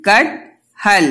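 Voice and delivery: slow कट हल, split into two chunks; slow chunked reading. Shown as a demonstration. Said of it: "दो दो करके पढ़ो आप".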